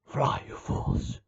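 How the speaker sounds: low, slow, whispered mock wizard warning; hushed dramatic plea to flee; whispered Gandalf line. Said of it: "he's like".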